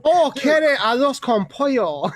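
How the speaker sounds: slow Spanish in a mock Chinese accent. Waiter takes an order in Spanish Harlem, mock Chinese accent. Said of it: "in Spanish with a Chinese accent".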